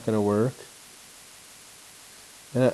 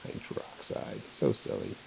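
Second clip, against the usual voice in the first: amused aside; 13 semitones lower, 13 dB quieter than usual. Real, not acted. Teacher mocking wrong answer.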